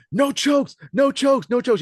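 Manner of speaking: very high, loud repeated yell; side ref yelling. Retold or imitated. Side referee yells at the fighters, loud high shout. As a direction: shout it across the mat. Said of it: "You're yelling really loud".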